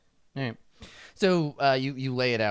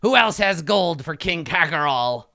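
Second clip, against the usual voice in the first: higher, louder triumphant boast; imperious kobold king; 4 semitones higher, 7 dB louder, slower than usual. Acted. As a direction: crow over your subjects regally. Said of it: "And he says".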